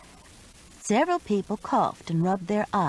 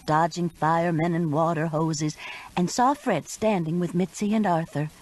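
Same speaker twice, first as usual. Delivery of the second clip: plain reading voice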